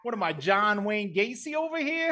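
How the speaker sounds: Brooklyn Vinny voice. Acted. Contestant introducing himself in a brash Brooklyn accent. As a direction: play a cocky Brooklyn guy on a dating show. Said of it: "Brooklyn Vinny".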